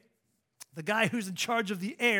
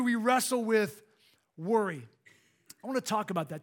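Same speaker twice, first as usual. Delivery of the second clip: ordinary delivery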